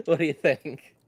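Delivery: normal talking voice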